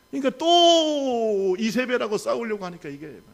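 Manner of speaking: fervent, high preaching voice. Acted. Pastor urging the congregation, fervent preaching.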